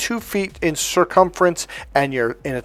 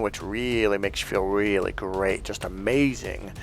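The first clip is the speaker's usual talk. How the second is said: low, soft, dreamy drugged voice; blissed-out on pills; 7 semitones lower, 10 dB quieter than usual. Acted. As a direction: sink down into mock opioid bliss. Describes the high from the pills.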